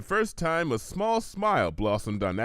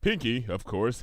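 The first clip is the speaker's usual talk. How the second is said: plain reading voice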